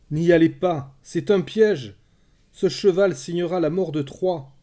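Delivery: loud, high-pitched urgent warning; crying out. A narrator's reading. Cassandra warning the Trojans of the trap, crying out. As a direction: shout to stop them from going. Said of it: "cria Cassandre".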